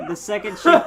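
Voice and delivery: ordinary delivery, nothing marked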